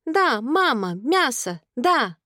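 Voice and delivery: put-on high, insistent toddler; childish high-pitched chatter; little boy. A narrator's reading. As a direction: insist happily in a small child's voice. Toddler voiced in story.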